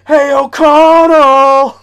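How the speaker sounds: high, loud, long-drawn holler; calling out. Acted. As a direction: holler the name from inside a cage, stretched out. Acting out a caged character calling.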